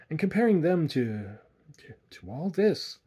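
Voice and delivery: groping for the words; stammering. A narrator's reading. Simpson comparing home woods to the bush, stammering. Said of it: "stammered Simpson".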